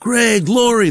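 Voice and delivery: high, drawn-out greeting; stoner friends. Retold or imitated. Doper friends hail him.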